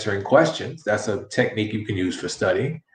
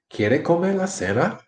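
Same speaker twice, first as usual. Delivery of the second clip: higher-pitched question, "cena" swinging up; question intonation; 8 semitones higher than usual. Shown as a demonstration. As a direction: ask "¿Quiere comer la cena?" high, ending in a rise on "cena". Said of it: "Can you hear the different inflection".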